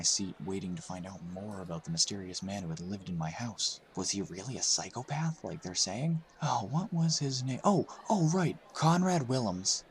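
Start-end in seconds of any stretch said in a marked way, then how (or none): 6.4-9.8 high, searching, then landing on the name; Evan remembering. A narrator's reading. Evan recalling the killer's name, lightly raised and searching.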